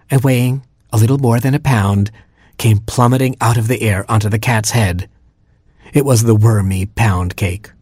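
ordinary delivery

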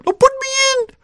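very high squeaky plea; tiny pleading voice. Acted. Little cherry begging the girl to play, tiny squeak. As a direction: beg like a tiny hero.

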